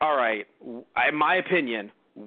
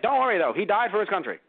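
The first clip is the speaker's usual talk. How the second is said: higher, lightly done 1920s New York newsreel voice; a hint of a 1920s New York propaganda announcer; New York nineteen twenties voice; 6 semitones higher than usual. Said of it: "doing the New York nineteen twenties voice".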